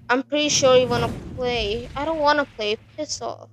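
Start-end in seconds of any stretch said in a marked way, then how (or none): none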